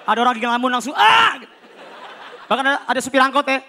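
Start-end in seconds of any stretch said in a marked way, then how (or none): 1.0-1.4 sudden high loud yelp; mock startled shout; startled yell. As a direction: jump and yell in fright. Startled passenger yells.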